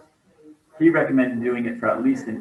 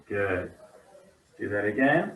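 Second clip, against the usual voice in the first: usual speaking voice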